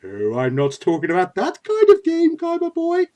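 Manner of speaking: teasing theatrical villain, rising high; Pegasus Maximilian. Acted. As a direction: toy with your rival, sweeping upward at the end. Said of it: "Pegasus Maximilian".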